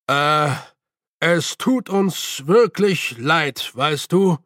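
slow, drawn-out elder's apology; old master's measured character voice; former worshipful master. A narrator's reading. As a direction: apologise haltingly as a senior order member. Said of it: "seine Stimme wies darauf hin".

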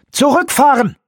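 high, loud yell; shouted command. Acted. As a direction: bark the retreat order. Bormann jumps up, orders retreat.

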